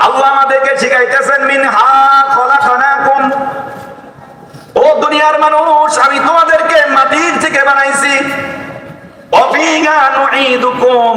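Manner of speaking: high, impassioned preacher's cry; fervent preaching. Acted. Preacher to the congregation about prayer, fervent high preaching. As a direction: lift the voice and preach to the crowd.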